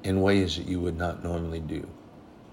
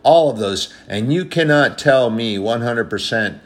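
louder and louder, driving the point home; rising sermon preaching; 5 dB louder than usual. Acted. Preacher urging the church to pray for leaders, building fervent preaching. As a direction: preach it to the congregation with growing force.

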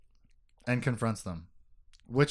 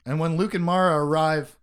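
ordinary talk, nothing marked

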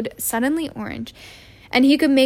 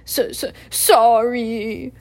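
slow, louder, sobbing apology; sobbing sorry; 5 dB louder, much slower than usual. Acted. Hagrid sobbing his apology to McGonagall, slow and tearful. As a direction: blubber the apology. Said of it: "sobbed Hagrid".

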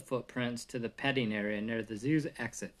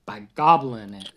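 louder, lower punchline shout; 5 semitones lower, 7 dB louder than usual. A narrator's reading. Morty and Ferdy call the joke answer, a loud low shout. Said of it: "shouted Morty and Ferdy".

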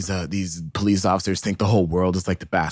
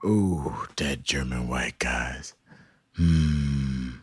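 low, slow savoring; 9 semitones lower, 6 dB quieter, much slower than usual. Real, not acted. Savoring a catchy line.

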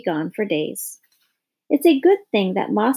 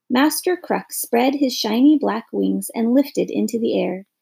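plain reading voice

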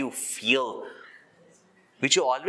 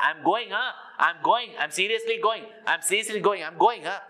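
mock threat; 8 semitones higher than usual. Husband threatening to leave.

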